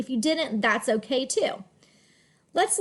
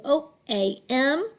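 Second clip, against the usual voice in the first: normal talking voice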